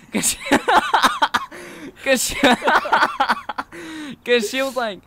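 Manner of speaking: helpless laughter breaking up the words; cracking up. Real, not acted. Friend retelling a funny hockey-table hit, cracking up. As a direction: keep trying to talk through a laughing fit.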